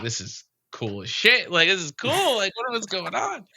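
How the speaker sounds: high-pitched, excited retelling of being floored; amazed freak-out. Retold or imitated. Storyteller reliving arrival on another planet, excited freak-out. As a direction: blurt it out with rising, giddy amazement.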